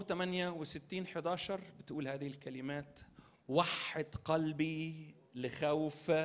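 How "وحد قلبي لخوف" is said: slow recital. Acted. Slow scripture quote in sermon.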